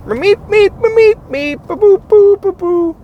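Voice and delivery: very high squeaky meeps; Beaker meeps. Retold or imitated. Comedian demonstrating Beaker sounds, very high squeak. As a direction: squeak nonsense like a Muppet. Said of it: "you can do it to anything".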